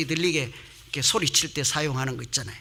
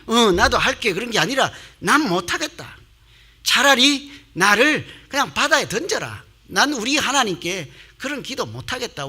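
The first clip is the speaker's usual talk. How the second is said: Jonah refusing; 6 semitones higher, 3 dB louder than usual. Acted. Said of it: "그랬더니 요나가 뭐래요".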